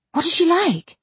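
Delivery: high, breathless eager question; in a breath. A narrator's reading. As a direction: ask all in one eager breath. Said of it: "inquired the Missus Eshton in a breath".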